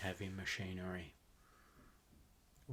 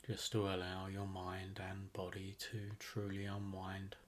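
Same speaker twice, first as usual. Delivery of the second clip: guided meditation; 3 dB quieter than usual. Acted. Host guiding listeners to relax into a rainforest visualization, guided meditation. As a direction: ease them into relaxation, phrase by unhurried phrase.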